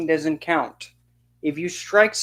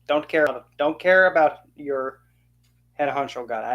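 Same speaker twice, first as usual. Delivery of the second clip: usual speaking voice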